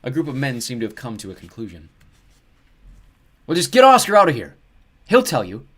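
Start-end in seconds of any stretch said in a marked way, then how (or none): 3.5-5.6 loud, high shout over a crowd. A narrator's reading. One man in a crowd calling to the others, shouting. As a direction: yell it out to the group. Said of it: "one of them shout".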